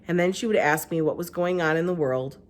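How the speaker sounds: plain reading voice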